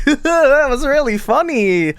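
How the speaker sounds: high, slow forced laughing praise; fake amused laughter. Mocked man pretending the joke was really funny, forced laugh. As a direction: pretend it's hilarious, stretching the words. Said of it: "but is it laughter?".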